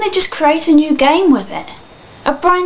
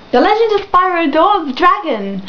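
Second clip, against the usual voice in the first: higher-pitched title blurt; sudden shout; 4 semitones higher than usual. Real, not acted. Vlogger blurting out the forgotten game title, shouted. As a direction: call the title out suddenly, high. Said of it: "sorry, I just shouted that".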